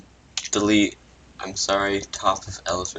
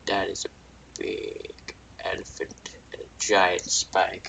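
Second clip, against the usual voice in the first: soft whispered awe; awed whisper; 2 semitones lower, 7 dB quieter than usual. Acted. Philip whispering in awe at the big elephant. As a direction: breathe it out in a hush.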